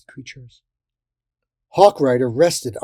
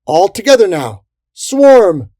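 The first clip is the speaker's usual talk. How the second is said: high, very loud battle cry; rally everyone to swarm; rallying shout; 8 semitones higher, 11 dB louder, slower than usual. A narrator's reading. Fairy leader sending in the swarm, rallying shout.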